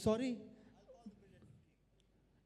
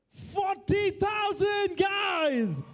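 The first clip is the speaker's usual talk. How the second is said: high, slow, stretched-out bid call; excited auction shout; 9 semitones higher, much slower than usual. Real, not acted. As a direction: belt out the new bid to the room. Auctioneer calls a rising bid.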